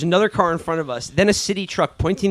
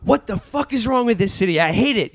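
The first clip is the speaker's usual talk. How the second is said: mock angry rant, higher pitched; imitated anger; 7 semitones higher than usual. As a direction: act out an angry New Yorker venting. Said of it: "angry, just going".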